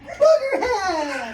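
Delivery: high, slow giggly pixie; giggling pixie voice. Acted. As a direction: cackle it like a pixie. Pixie voice laughing.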